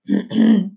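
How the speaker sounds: acted clearing of the throat. A narrator's reading. Father stopping before telling his son the truth, throat clearing. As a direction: clear your throat before a hard admission. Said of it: "He stopped and cleared his throat".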